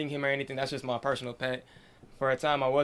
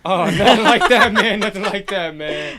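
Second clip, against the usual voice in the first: laughing it off, higher and much louder; 6 semitones higher, 15 dB louder, slower than usual. Real, not acted. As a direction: burst out laughing while denying it. Joking question about lizard's identity.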